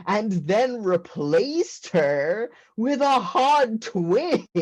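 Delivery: high, slow, incredulous; exasperated laughing. Retold or imitated. Telling mom her secrecy looks like murder, high exasperated. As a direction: plead with rising disbelief.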